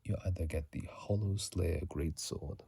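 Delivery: ordinary delivery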